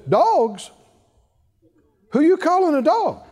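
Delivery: mock indignant retort, rising high at the end. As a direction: act out someone taking offense.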